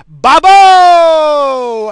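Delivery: long, high, loud bellow; derisive nickname shout. Acted. Host awarding a fuck you to his coworker, shouting. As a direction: stretch the name out into a mocking holler.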